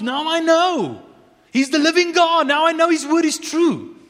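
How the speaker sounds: high, loud proclaiming sermon voice; rising, ringing pulpit cry; full-force preaching. Acted. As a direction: proclaim it to the back row.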